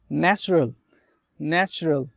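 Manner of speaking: "ন্যাচারাল" said as NATCH-ruhl; correct pronunciation. Shown as a demonstration. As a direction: model "ন্যাচারাল" with a ch sound. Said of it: "Try it".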